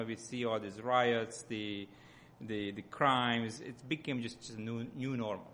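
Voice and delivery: ordinary talk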